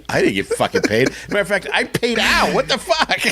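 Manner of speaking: high, laughing protest; hammed-up grievance through laughter; mock outrage. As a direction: complain in mock indignation, breaking into laughter. Acts out joking grievance over pay.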